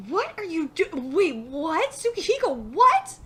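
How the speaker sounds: loud, rising disbelief; real shock. Real, not acted. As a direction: blurt it out, stunned. Shocked reaction to the plot.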